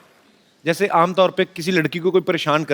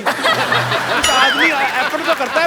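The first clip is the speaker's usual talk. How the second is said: high, comic exasperated urging; raised-pitch ringside heckling; 6 semitones higher than usual. Real, not acted. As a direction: egg on a hopeless wrestler. Host egging on losing wrestler.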